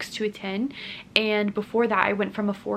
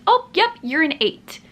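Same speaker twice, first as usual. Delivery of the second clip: higher, louder imitation of a nurse's quick confirmation; imitated nurse's announcement; 8 semitones higher, 6 dB louder than usual. Nurse announces the exam result.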